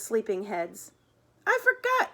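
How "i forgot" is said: high-pitched, dismayed exclamation; sudden high yelp of realisation; crying out. A narrator's reading. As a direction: cry it out way up high. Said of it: "cried Murray".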